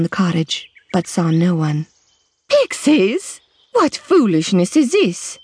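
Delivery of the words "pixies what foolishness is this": high sharp snap; snapped. A narrator's reading. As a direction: snap it back scornfully. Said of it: "she snapped".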